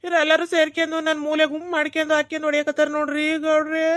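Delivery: tearful wailing complaint ending in sobs; mock crying. Husband tells headman they ganged up on him, mock crying. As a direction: sob it out like a hammed-up victim. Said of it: "ಎದಕ್ಲೆ ಅಳಕತ್ತೀಯ".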